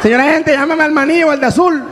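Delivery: high-pitched call to the police. Acted. Onlooker calling over the police, high hailing shout. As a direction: hail the officers from a distance.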